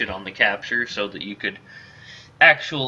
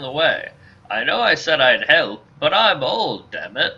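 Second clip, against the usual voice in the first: put-on old mercenary; 2 semitones higher than usual. Old mercenary briefing Ender on the kidnapping, old man voice.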